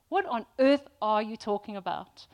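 loud, incredulous question; baffled exasperation. Acted. Confused listener faced with submission, loudly baffled. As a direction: act out total bafflement at the rules. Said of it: "that is how we feel".

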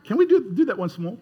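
ordinary talk, nothing marked

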